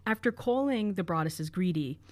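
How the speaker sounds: normal talking voice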